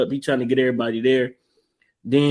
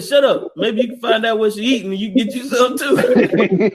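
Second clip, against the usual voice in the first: higher, cranky retort; hammed-up grumpy husband; mock grumpy old man; 6 semitones higher than usual. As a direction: snap back in a cranky voice. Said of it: "He said".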